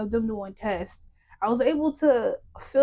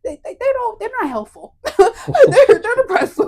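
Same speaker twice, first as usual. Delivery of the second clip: laughing; 11 semitones higher, 9 dB louder than usual. Real, not acted. Laughing at doctors' bleakness.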